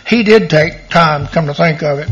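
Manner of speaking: ordinary talk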